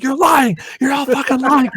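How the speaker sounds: very high, desperate shouting at liars; frantic accusation. Retold or imitated. Father accusing the friends of hiding his baby, frantic and high. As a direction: scream the accusation in panic.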